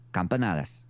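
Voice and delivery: Campanadas in a Spanish accent. Shown as a demonstration. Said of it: "I really wasn't expecting the accent to come through there".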